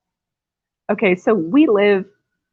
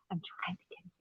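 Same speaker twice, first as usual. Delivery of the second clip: whispering; 23 dB quieter, faster than usual. Real, not acted. Husband nearby, talking about him.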